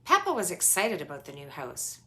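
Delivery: usual speaking voice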